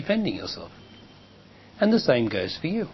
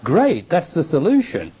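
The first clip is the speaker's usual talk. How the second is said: louder and higher, rising to a sharp Go; breezy dismissal; 5 semitones higher, 6 dB louder than usual. Retold or imitated. Teacher acting the woman sending the man away, breezy loud dismissal. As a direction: brush him off cheerfully. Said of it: "then you say".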